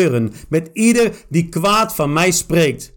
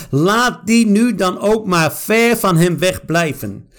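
usual speaking voice

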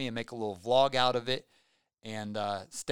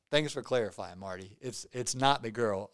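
usual speaking voice

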